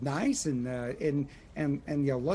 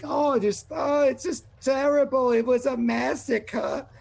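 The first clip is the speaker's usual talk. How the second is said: distraught gnome; 8 semitones higher, slower than usual. Acted. Bloodied gnome telling the party about the massacre, distraught. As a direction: wail it out, shaken and distraught. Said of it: "there is Billy who is there, just like".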